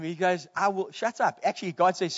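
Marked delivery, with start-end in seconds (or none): none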